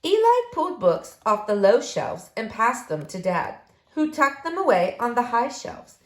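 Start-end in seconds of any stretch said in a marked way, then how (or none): none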